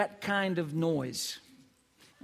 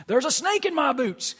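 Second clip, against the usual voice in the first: higher, louder, sing-song teasing line; playful baby talk; 6 semitones higher, 4 dB louder than usual. Acted. Father teasing his baby son Nate, playful high voice. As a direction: tease the baby to make him giggle.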